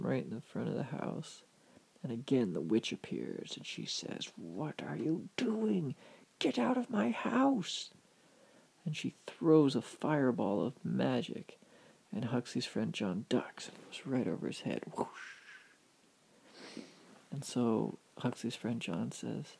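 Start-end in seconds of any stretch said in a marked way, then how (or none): 4.4-7.9 drawn-out question rising to a high-pitched order; angry witch. A narrator's reading. Witch ordering John out of her house, high acted outrage. 14.9-15.3 airy imitated whoosh; whoosh sound effect. A narrator's reading. Storyteller as magic fireball flies over John's head, imitated whoosh.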